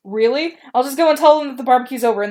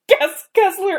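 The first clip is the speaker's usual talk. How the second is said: laughing; 9 semitones higher, slower than usual. Real, not acted. Laughs reading.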